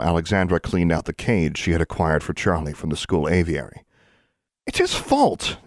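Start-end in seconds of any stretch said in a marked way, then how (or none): 4.7-5.6 high-pitched insistent accusation; indignant blame. A narrator's reading. Alexandra blames Larry to Anna, indignant and high. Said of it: "Alexandra said".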